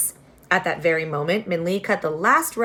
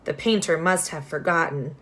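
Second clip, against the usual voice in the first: ordinary delivery, nothing marked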